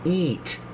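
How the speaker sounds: "eat" with a long, high-pitched EE; long E sound. Shown as a demonstration. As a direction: stretch the vowel in "eat". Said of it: "we have the long E".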